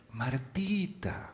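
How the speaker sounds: slow, soft, drawn out Martita; affectionate diminutive. Shown as a demonstration. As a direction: coo the nickname tenderly. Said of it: "en nuestro español que decimos". (diminutivo cariñoso, lento y suave)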